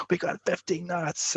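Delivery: high, slow, drawn-out cry of joy; joyful mock scream. Crewmate [name] cheering the record speed, high and slow joyful yell. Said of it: "screaming for joy".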